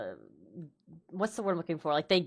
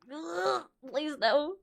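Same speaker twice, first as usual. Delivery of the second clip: groaning dread; 9 semitones higher, much slower than usual. Retold or imitated. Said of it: "I'm like".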